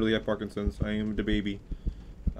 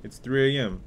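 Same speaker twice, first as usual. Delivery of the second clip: normal talking voice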